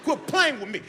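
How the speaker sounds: high mock-angry accusation. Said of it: "I was like".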